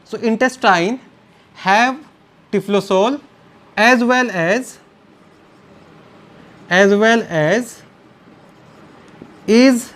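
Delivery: slow, word-by-word delivery for note-takers; slow dictation. Real, not acted. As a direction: dictate in short chunks with long pauses. Dictating notes slowly to students.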